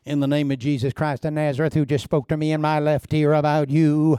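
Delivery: mock prophet's proclamation in a put-on voice; hammed-up holy pronouncement; trumpet-blowing prophecy. Shown as a demonstration. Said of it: "don't blow the trumpet in Zion".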